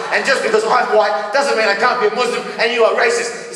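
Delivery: telling off the cashier, mock furious and high; mock indignant rant. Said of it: "I said".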